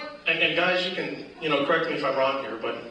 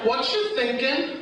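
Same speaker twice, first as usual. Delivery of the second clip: put-on lightly raised sweet girlfriend; 6 semitones higher, slower than usual. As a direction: coo it slowly at him. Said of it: "his girlfriend looks at him and says".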